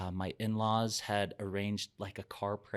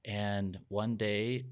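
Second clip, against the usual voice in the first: normal talking voice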